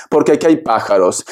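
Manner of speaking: normal talking voice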